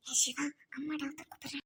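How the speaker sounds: unvoiced hushed whisper. Whispering to Siva so mother cannot hear.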